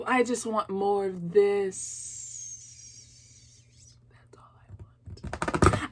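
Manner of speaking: soft, slow whisper; hushed longing. Real, not acted. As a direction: wistfully whisper your wish. Hushed longing after apology.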